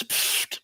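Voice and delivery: voiceless puff of air through the lips; dismissive pfft. Retold or imitated. Streamer reading out a viewer's dismissive message, voiceless pfft. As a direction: blow a short dismissive raspberry-like pfft.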